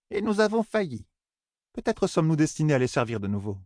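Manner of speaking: high-pitched matriarch, easing down; elder admitting failure. Acted. Voices the elder Beyr.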